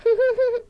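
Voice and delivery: high, real giggle. Real, not acted. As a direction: let out a short, high laugh. Laughing at own retold remark.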